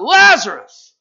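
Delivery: a big loud call, pitched high; yelled out. Acted. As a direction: shout the name to wake the dead. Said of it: "he yelled out".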